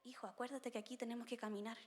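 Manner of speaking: soft, calm positive instruction; gentle reminder. Retold or imitated. Parent gently reminds the child in church, soft and calm. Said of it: "en vez de decirle".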